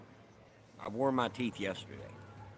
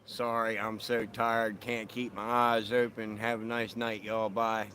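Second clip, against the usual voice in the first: ordinary delivery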